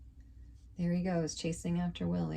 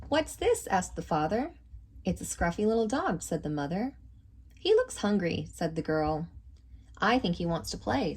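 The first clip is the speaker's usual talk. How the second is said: lively storybook reading voice; reading to children. A narrator's reading. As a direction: read it out like to a class of kids. Reads picture book aloud.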